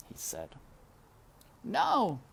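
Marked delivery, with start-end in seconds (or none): 1.6-2.2 high, denying mouse voice; a small high no. A narrator's reading. Despero denies knowing the knight, high. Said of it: "said Despero".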